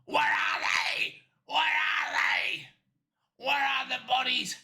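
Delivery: slow, forceful rasping yell; shouted interrogation. Acted. Cop grabbing the killer to find the bodies, shouted demand. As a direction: demand it in a hammed-up hoarse shout.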